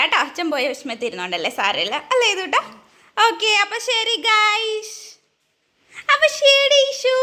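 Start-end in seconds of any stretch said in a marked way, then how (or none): 3.3-7.1 high, slow drawn-out sign-off; sing-song goodbye. Real, not acted. Mother bidding viewers and Ishu bye, high sing-song.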